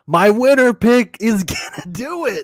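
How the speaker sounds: high, loud shout imitating a triumphant fan; excited yell. Co-host imitating the other's cheer for a winner pick, excited yell. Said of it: "you stood up and you yelled".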